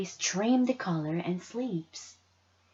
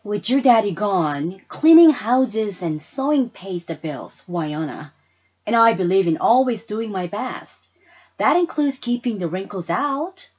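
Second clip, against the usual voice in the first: firm mother; 8 dB louder than usual. Acted. Mama answers her daughter firmly.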